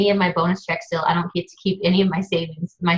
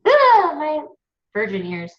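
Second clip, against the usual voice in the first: mock scandalised gasp; 7 semitones higher, slower than usual. Mocking herself over the author's cussing, scandalised prude. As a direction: play the shocked prude for a laugh. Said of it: "so I'm like".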